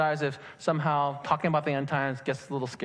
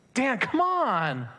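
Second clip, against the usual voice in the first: friend pleading; 8 semitones higher, 3 dB louder, much slower than usual. Retold or imitated.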